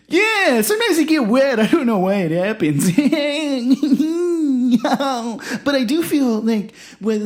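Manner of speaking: a bubbly character laughing through the lines; high excitable character voice. Acted.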